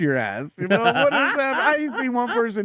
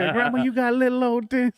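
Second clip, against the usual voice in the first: high-pitched mock toddler line; joke callback; 9 semitones higher than usual. Comic reviving old joke about grandma, high and cheeky. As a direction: call it out high like a cheeky kid.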